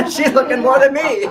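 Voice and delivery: laughing loudly up high. Real, not acted. Joking about wife looking at girls, loud laughter. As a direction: burst out laughing as you say it.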